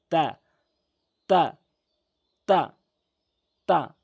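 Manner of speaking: তা with tongue tip behind upper front teeth; teaching demo. Shown as a demonstration. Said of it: "তা শুদ্ধ উচ্চারণ হবে".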